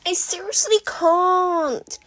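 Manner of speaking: high, loud, slow exclamation; exclaimed. A narrator's reading. May exclaiming to Izuku, mock despair. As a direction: cry it out in despair. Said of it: "she exclaimed".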